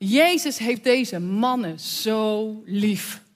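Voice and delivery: ordinary delivery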